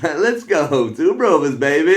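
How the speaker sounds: loud, high rowdy whoop; hollering. Retold or imitated. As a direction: shout it out, hyping up your mate. Retold hollering along the street.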